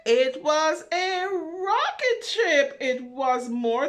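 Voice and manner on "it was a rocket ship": higher, louder, slow announcement; grand reveal. Acted. Reader to children, revealing the rocket with excitement. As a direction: unveil it like a big surprise.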